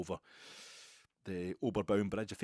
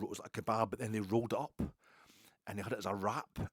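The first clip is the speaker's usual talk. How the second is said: ordinary delivery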